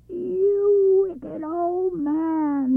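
high, very slow, very loud roar. Acted. Wind roaring at the old man in fury. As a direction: bellow it with fury. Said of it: "he roared at Machbel".

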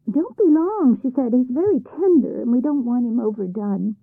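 loud, high calling after him; troll wife calling. A narrator's reading. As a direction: call out eagerly to the departing troll. Troll wife calls after husband.

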